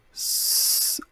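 "s" as a near-silent voiceless hiss; letter sound s. Shown as a demonstration. Teacher hissing the "s" sound for a beginning reader. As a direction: breathe out "s" without voice. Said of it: "Je te donne les sons".

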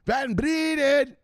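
high, loud, slow shout; blaring phone. Retold or imitated. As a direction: blast it from a maxed-out phone. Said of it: "iPhone turned all the way up".

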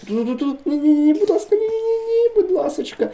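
high, frantic squeal of panic; panicked pleading. Real, not acted. Panicked under attack.

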